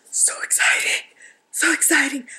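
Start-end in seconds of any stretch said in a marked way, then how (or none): 0.1-1.1 thrilled, voiceless whisper; excited whisper. Real, not acted. Crafter marvelling at the bits and pieces sent to her, excited whisper. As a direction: whisper it with breathless delight.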